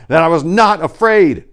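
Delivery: loud, high cry of false courage; defiant shout. A narrator's reading. Narrator in the dark streets, shouting defiantly. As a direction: shout down your own fear. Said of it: "I screamed aloud".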